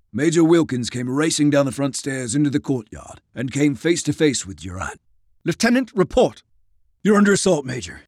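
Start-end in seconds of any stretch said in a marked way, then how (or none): 5.4-8.0 raised, high-pitched officer's voice; urgent military exchange. A narrator's reading. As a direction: report under attack, urgently. Major and lieutenant in urgent exchange.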